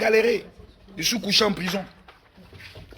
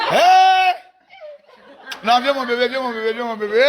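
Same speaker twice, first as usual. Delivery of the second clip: cri joyeux plus aigu en riant; joyeux appel; 4 semitones higher, 4 dB louder than usual. Retold or imitated. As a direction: appelle le bébé avec éclat et tendresse. Greeting his baby, laughing. (accueille son bébé avec un cri joyeux et des rires)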